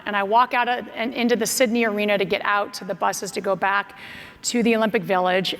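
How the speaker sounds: ordinary delivery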